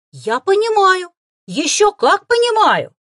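very high, very loud, slow eager assurance; nodding with joy; eager delight. Acted. Boy assures the fox he understands, ecstatic. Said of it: "восторженно закивал мальчишка".

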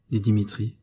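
ordinary delivery, nothing marked

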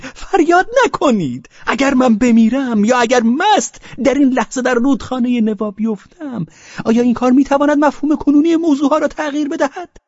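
high, loud pleading wail; drunken cry. A narrator's reading. Pavel cries out tearfully to Velchaninov. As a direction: cry out begging him to stop. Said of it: "دست به فریاد گذاشت".

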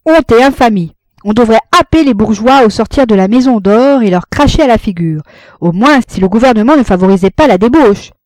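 loud, high thundering tirade. A narrator's reading. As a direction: thunder against the corruption. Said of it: "il tonna contre la corruption". (tonner avec indignation)

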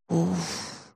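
soft heavy exhale; выдох. A narrator's reading. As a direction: let out a long, quiet puff. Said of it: "выдохнул Сабай". (тихо и шумно выдохнуть)

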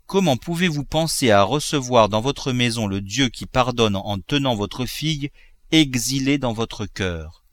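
plain reading voice

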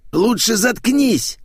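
high, drawn-out snap; barked order. A narrator's reading. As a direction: bark it in a high, stretched voice. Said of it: "рявкнул попугай".

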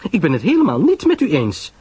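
high, swooping woman's voice; indignant disagreement. Acted. Pamela disagrees outright with Poirot, high and emphatic. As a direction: contradict firmly, pitched high.

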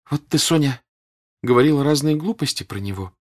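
put-on gentle child angling for approval; meek, childlike. A narrator's reading. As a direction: speak meekly, like a child wanting praise. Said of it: "кротким голосом, тем голосом, которым говорят дети".

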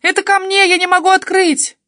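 loud, high-pitched desperate shouting; panicked yell. A narrator's reading. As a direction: yell for help through the blocked door. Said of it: "завопила я".

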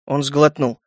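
usual speaking voice

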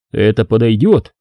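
ordinary delivery, nothing marked

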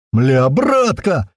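slow, drawn-out delighted cry; overjoyed. A narrator's reading. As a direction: stretch it out high in sheer glee. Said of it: "Ещё больше развеселился Страйк".

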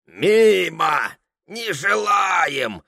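high, drawn-out call; old man's cry. A narrator's reading. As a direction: cry out a refusal, stretching the words. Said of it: "закричал белобородый румяный старик".

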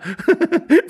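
very high tittering laugh; lady's giggle. Acted. Wife amused by her husband, high giggle. Lady giggles before asking.

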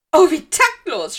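high, loud, theatrical sob; schluchzend empört. A narrator's reading. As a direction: wail in offence as a ghost girl. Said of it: "schluchzte Myrtle". (laut und hoch aufschluchzend)

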